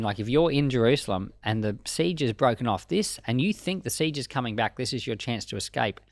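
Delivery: ordinary delivery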